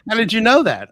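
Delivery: high, loud astonished question, imitating the client; amazed. Imitating an amazed client asking how he knew, high and loud. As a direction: act out her stunned disbelief.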